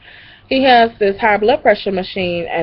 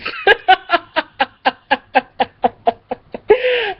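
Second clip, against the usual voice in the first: high helpless cackle; 10 semitones higher than usual. Real, not acted. Cracking up over the nephew's comment. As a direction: shriek of laughter. Laughing hard at story.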